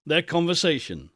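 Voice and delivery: plain reading voice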